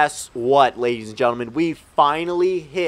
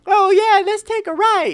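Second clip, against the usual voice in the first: high-pitched, louder mock of the driver; mocking imitation; 4 dB louder than usual. Teller mocks the bus driver's wrong turn, mocking imitation. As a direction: imitate her in a silly high voice.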